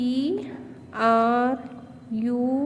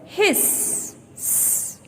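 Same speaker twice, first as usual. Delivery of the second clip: snake hiss; 6 semitones higher, 5 dB quieter than usual. Shown as a demonstration. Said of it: "Can you guess?".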